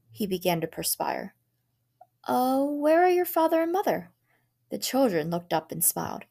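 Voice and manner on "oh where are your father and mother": cheery visitor. A narrator's reading. Visitor asks the children.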